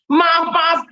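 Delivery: loud, sneering repeat of the apology; furious driver mocks the apology; mocking angry driver. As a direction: throw his sorry back in his face.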